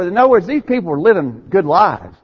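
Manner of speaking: ordinary delivery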